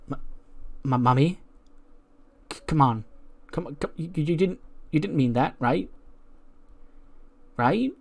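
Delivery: stammering, soft, rising plea; distraught pleading. Acted. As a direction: beg her not to mean it, stammering.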